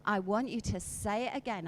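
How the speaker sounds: normal talking voice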